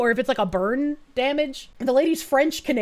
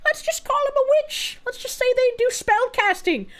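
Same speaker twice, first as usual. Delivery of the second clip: higher, scheming mock voice; mock scheming plotter; 10 semitones higher than usual. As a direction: play a sneaky plotter hatching a plan. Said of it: "They're just like".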